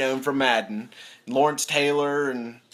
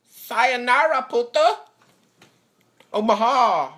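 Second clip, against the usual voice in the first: much higher, louder playful call; shouted sign-off; 10 semitones higher, 5 dB louder, slower than usual. Real, not acted. Vlogger signing off to viewers, playful shout. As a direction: belt the goodbye like a cheer.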